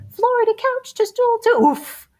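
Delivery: comic oof. Pretend. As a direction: land the oof like a kitten falling. Kitten falls, oof.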